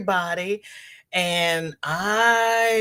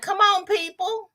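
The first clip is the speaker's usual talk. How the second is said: exasperated; 13 semitones higher than usual. Retold or imitated. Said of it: "I was like".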